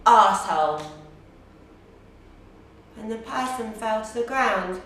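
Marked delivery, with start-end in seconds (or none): 0.1-0.8 sudden louder insult; retold scream. Woman finally confronting the shopper in her way, loud retold outburst. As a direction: punch out the insult loudly. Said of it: "and I screamed".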